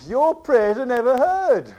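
loud, high, slow retort; emphatic rebuff. Retold or imitated. Finney refusing the meeting's prayers, loud and emphatic. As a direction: declaim it slowly as a blunt accusation.

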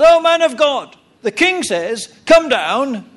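high, loud call up the hill. Retold or imitated. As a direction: shout up to the prophet on the hill. Captain calls up to prophet.

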